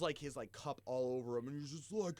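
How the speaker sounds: usual speaking voice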